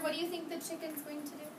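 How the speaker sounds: ordinary talk, nothing marked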